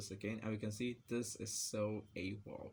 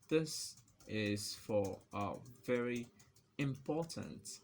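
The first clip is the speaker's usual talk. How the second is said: slow, halting, with pauses; typing-pace dictation; slower than usual. Real, not acted. Typing example text for viewers, slow dictation. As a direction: spell it out word by word.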